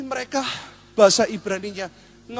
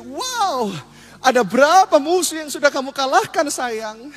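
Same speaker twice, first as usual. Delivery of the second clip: high, gushing wifely question; admiring wife; 4 semitones higher, 3 dB louder, slower than usual. Acted. Preacher as wife asking soldier about victories, admiring. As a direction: gush with pride, voice lifted. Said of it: "Pasti istri bilang gini".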